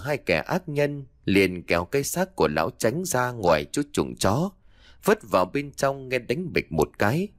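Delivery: plain reading voice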